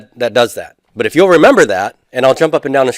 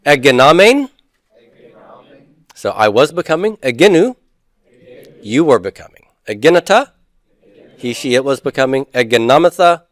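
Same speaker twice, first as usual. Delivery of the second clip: recitation drill. Shown as a demonstration. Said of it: "let's just say these for fun".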